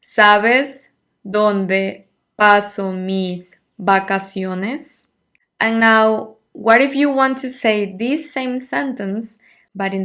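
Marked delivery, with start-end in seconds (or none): 0.0-5.1 very slow, deliberate Spanish question; slow model question. Shown as a demonstration. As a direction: stretch every word so students can copy it. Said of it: "It would be".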